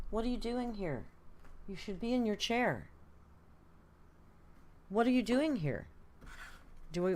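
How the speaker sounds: playful pet voice, surprised he came; talking to the cat. Real, not acted. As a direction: coo at the cat as he jumps up.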